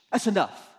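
firm reprimand. Acted. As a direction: put an end to the misbehaviour. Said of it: "looks at the wind and the storm and says".

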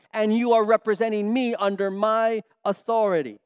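God firmly reminding Moses whose son he is; fervent preaching. Pretend. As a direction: press the point on Moses with fire. God speaking to Moses, voiced.

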